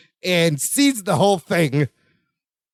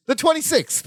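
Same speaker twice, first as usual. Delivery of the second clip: higher-pitched answer after a beat; acted afterthought; 7 semitones higher than usual. [name] working out when she needs it, high-pitched realization. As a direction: pitch it up as it occurs to you.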